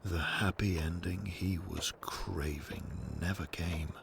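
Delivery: ordinary delivery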